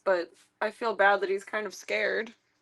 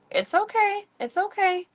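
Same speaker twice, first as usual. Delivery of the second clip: very high, gentle pet reassurance; high soothing; 11 semitones higher than usual. Real, not acted. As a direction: coo the comfort up high. Soothing a scared cat.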